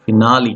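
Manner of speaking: "finale" as fi-NAH-lee; pronunciation ending in LEE. Shown as a demonstration. Teacher shows second pronunciation, ending in LEE. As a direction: end "finale" on a clear LEE. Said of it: "there are two pronunciation".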